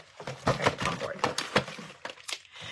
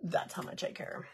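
soft breathy aside; whispered dismissive; 3 semitones lower, 11 dB quieter than usual. Shown as a demonstration. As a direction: breathe it out, barely caring. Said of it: "If I could put my fingers".

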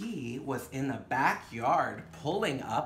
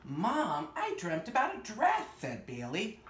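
gentle storybook narration with wonder; story reading to children; 6 dB louder than usual. Acted. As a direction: read it like a bedtime picture book. Reads picture book aloud.

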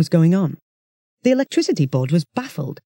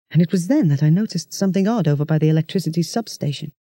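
usual speaking voice

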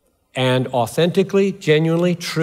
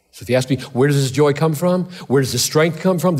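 usual speaking voice

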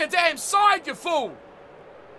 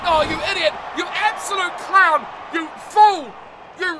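louder, fed-up insults at a teammate; exasperated yelling; 3 semitones higher, 5 dB louder than usual. Real, not acted. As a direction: yell it at the screen in frustration.

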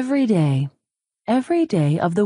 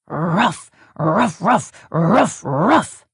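dog barking; slower than usual. Acted. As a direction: bark it out like a grumpy dog. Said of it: "Dog disagreed, barking".

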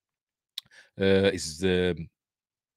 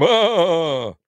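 high, drawn-out "ah" of glee; hammed-up high laughing cry; loud, silly wail of amusement; mock cackle; 9 semitones higher, 5 dB louder than usual. Tops off the silly bottom bit.

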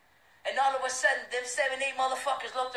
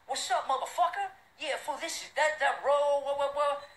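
mock gang taunt; 6 semitones higher than usual. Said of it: "they were saying".

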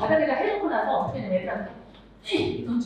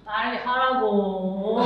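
drawn-out order; 4 semitones lower, much slower than usual. Shown as a demonstration. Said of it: "짧아도 무섭고".

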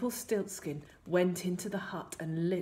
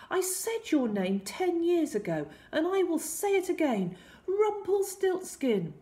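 high, louder queen's voice; defiant queen; 11 semitones higher, 5 dB louder than usual. A narrator's reading. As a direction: stand up to the intruder, high and forceful. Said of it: "the Queen gasped".

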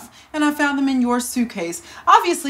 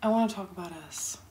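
quick, low, soft urging; hushed; 5 semitones lower, 11 dB quieter, faster than usual. Acted. Lover wants to talk about us, hushed. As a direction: say it quickly under your breath. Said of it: "Why are we whispering and sneaking around?".

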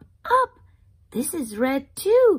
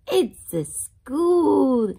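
slow reveal; 4 semitones higher, 5 dB louder, much slower than usual. Acted. Slow drawn-out answer to question.

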